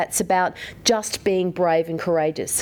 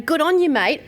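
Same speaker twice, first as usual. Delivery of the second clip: higher, louder blokey cheer; hearty mate; 7 semitones higher, 3 dB louder than usual. Retold or imitated. To the audience, imitating boys cheering each other, higher and louder. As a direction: clap a mate on the back with it. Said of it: "You know".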